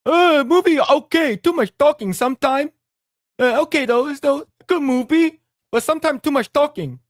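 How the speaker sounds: doing his dad's broken English movie take; dad's accent. Retold or imitated. Son imitates his dad reviewing the movie in a high accent. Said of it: "I'm gonna do an accent for my dad".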